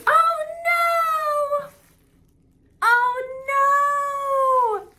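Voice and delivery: high, wailing oh no; real dismay at a broken gift; dismayed cry. Real, not acted. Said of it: "I'm so sad now".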